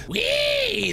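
high squealed whee; gleeful whee. Real, not acted. Gamer gliding through the water, a high gleeful whee. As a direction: squeal with delight as you swim. Gamer swimming along happily.

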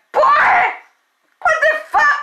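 high cry-shout of disbelief; shrieking mom. Retold or imitated. Mother shrieking at her son over the girl, high cry-shout.

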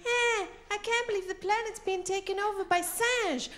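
high-pitched dubbed film hero, French word dropped in. Retold or imitated. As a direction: strain it high in mock disbelief. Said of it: "it looks like this".

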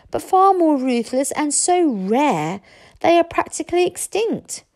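put-on high, deliberate boyish lecture; eager young friend. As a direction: talk up the breed to impress the chief.

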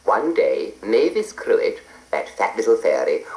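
usual speaking voice

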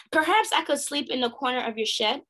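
ordinary delivery, nothing marked

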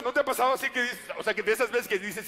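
usual speaking voice

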